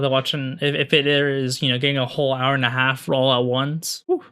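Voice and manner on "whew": ordinary talk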